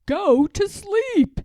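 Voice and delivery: high, loud, slow shout. Acted. Toad shouts to Frog that sleep was on his list, high and slow. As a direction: shout it out like a discovery. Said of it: "shouted Toad".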